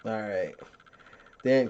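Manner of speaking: normal talking voice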